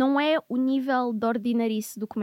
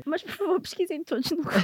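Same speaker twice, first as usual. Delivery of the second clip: laughing; 6 semitones higher than usual. Real, not acted. Host laughing, urging search.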